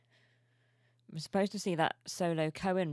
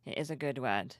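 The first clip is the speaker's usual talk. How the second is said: usual speaking voice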